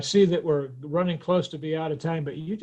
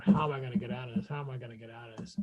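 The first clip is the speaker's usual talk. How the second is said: hushed, hurried mock panic; retold panic; 4 semitones lower, 9 dB quieter, much faster than usual. Woman retelling being trapped by a man at the door, scared and hushed. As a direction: fret under your breath, quickly. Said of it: "I was really scared at that point".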